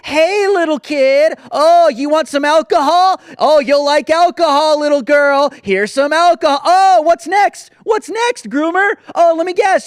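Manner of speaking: loud, sneering mock of the offer; sarcastic mockery. Host loudly mocking the drag queen's offer to the child.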